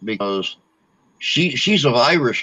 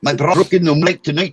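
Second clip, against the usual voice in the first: My bra broke gabbled fast, sounds slurring; rushed tongue twister; 7 semitones higher, 3 dB louder, faster than usual. Shown as a demonstration. Racing the tongue twister to make friends laugh. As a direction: rattle it off fast and trip. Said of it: "try and say it ten times".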